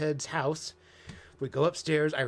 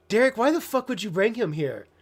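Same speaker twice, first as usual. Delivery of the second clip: higher and louder, an imitated exasperated demand; retold angry outburst; 7 semitones higher, 5 dB louder than usual. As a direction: recreate the man snapping at the cousin, higher and louder. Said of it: "He was like".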